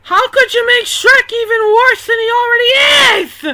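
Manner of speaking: high, loud, appalled yelling; horrified shout. Real, not acted. Reacting to Shrek's ugly sprite, horrified and yelling. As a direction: scream in disgust at the ugly sprite.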